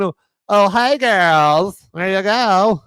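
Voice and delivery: high, loud talk-show hostess greeting; The View hostess impression. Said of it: "hostesses of The View, uh, impression".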